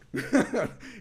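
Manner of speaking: high, loud burst of laughter; real laugh. Real, not acted. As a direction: burst out laughing at your own fright. Laughs at being scared.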